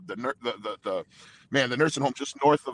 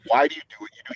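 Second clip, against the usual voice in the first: louder, higher pressing question; insistent questioning; 4 semitones higher, 7 dB louder, faster than usual. Retold or imitated. Asking the police commander on the phone why, insistent. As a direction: press him for a real answer.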